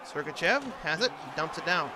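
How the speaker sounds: normal talking voice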